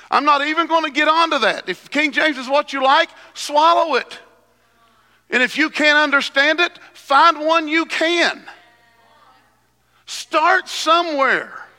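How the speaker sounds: high, loud pulpit preaching; preaching at full force. Acted. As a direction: preach it out to the congregation.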